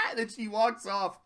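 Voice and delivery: usual speaking voice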